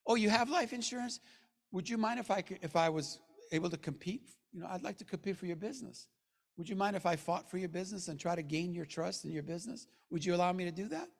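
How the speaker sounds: soft, humble request for business; polite soft pitch. Shown as a demonstration. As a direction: gently ask permission to compete. Demonstrates polite pitch to a prospect.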